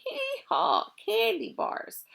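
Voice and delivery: high braying laugh into the words. Acted. Mac laughs at the thieves over the candy, high. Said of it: "and the laughter began again".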